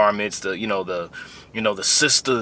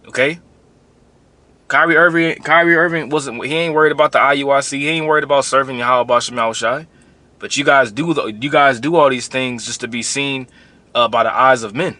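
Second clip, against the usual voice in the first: heated preaching; 4 semitones higher, 6 dB louder than usual. Real, not acted. Heated rant against the other camp.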